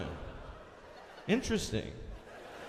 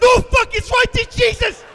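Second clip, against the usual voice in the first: very high, very loud panicked yelling; acted full-volume terror; terrified scream; 15 dB louder than usual. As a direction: shriek as the ground suddenly appears. Acting terror at seeing the ground.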